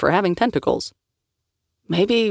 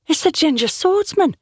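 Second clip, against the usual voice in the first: higher, excited bystander voice; voice in the crowd; 12 semitones higher than usual. Acted. Onlooker in the crowd spotting Liam, high and excited. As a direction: pitch it up like a stranger recognising him. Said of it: "said a voice in the crowd".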